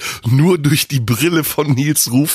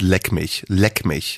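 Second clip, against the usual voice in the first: low, curt brush-off; deadpan low rebuff; dismissive leck mich; 5 semitones lower, 3 dB quieter, much slower than usual. Shown as a demonstration. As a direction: drop the voice and wave it off. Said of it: "antworte ich nur noch mit".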